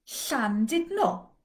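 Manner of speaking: Llandudno slow, ll as a hissed breathy l; slow Welsh ll. Shown as a demonstration. Teacher demonstrating the Welsh double L slowly. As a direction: Llandudno with the tongue hissing air at the sides. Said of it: "I'm gonna say a series of words with L".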